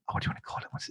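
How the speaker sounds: soft whisper to himself, searching for the word; whispered aside. Real, not acted. Host muttering to himself mid-explanation, whispered. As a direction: breathe it under your breath while thinking.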